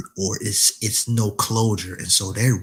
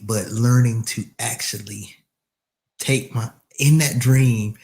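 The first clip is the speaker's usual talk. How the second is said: usual speaking voice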